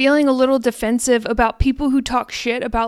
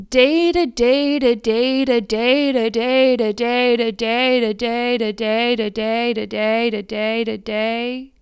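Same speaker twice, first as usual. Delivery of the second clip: droning repetition; 2 semitones lower than usual. Real, not acted. Podcaster working daily on letting go, droning repetition. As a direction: chant it in one long unbroken loop. Rolling repetition of one phrase.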